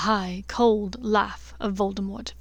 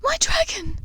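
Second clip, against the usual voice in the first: breathy broken whisper, high and pleading; a mother's grief breaking through; whispered tearful cry; 14 semitones higher than usual. Acted. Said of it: "Narcissa's tear-filled voice broke".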